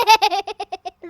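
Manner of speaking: loud, high gleeful laugh; cheeky laugh. Acted. Sam mocking angry villagers, loud gleeful laugh. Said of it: "Sam just laughed".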